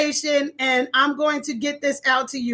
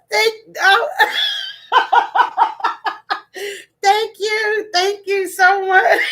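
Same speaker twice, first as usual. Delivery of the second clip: high thanks squeezed through laughter; giggling, overwhelmed gratitude; uncontrollable laughter; 6 semitones higher, slower than usual. Real, not acted. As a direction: thank them while cracking up. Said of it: "I can't stop laughing".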